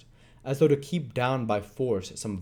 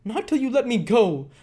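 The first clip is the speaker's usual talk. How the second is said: loud, much higher scream; screamed refusal; 14 semitones higher, 7 dB louder than usual. Acted. Nancy screaming at Sikes, loud. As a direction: refuse him at full voice. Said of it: "screamed the girl".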